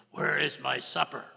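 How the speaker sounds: loud, gruff demand; loud hungry husband. Acted. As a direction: bark for supper. First man demands supper.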